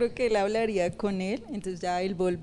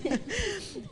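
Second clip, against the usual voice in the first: sudden high giggle, softer; high laugh; 11 semitones higher, 7 dB quieter than usual. Real, not acted. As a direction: giggle at your own story. Se ríe de su propia historia. (risita aguda)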